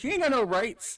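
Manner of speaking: high, loud hammed-up yell at a kid; mock yell. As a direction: shout it down at a bratty kid. Retold father yelling at kid.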